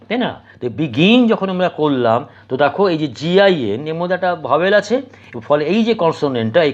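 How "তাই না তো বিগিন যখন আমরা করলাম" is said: usual speaking voice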